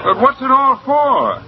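high, slow, baffled demand; exasperated question. Acted. American asks the manager the point of the scheme, exasperated. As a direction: draw it out, pitched high, wanting an answer. Bewildered man demands an explanation.

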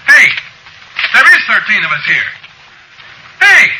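high, loud yell; yelling for attention. Acted. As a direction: yell across the room at someone ignoring you. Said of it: "I yelled at the D A".

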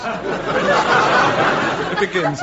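slow, giggling, barely gets it out; laughing through it. Real, not acted. Narrator reads the opening while cracking up, slow and giggling. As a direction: fight the giggles and lose. Narrator corpsing before reading.

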